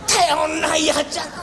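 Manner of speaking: exasperated scolding. Acted. Father scolds the son.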